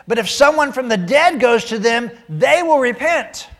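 loud, high-pitched arguing; insistent protest. A narrator's reading. Rich man objecting to Abraham, loud insistent protest. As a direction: argue back forcefully, slowing on the last words.

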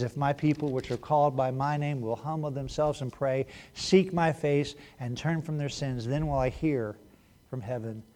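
soft, measured scripture reading. Acted. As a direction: read it gently, like a promise. Reads scripture quietly.

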